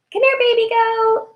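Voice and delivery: put-on very high cooing baby talk. Mother cooing at her daughter the baby goat, very high baby talk. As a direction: coo at her like a baby animal.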